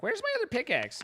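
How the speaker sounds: panicked squeak. Retold or imitated. Gamer retelling losing his pickaxe in a high mock-panicked squeak. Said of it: "I was like".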